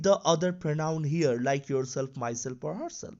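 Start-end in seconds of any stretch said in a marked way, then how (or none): none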